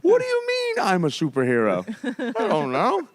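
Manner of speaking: mock reluctant hero. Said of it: "You do have the".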